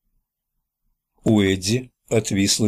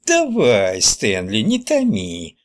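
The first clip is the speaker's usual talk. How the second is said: falsetto; slower than usual. Acted. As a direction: exclaim in a drawn-out old-woman falsetto. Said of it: "фальцетом воскликнул Ричи". (фальцетом, как бабка-ворчунья)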